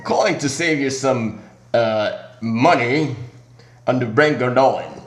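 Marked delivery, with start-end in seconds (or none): none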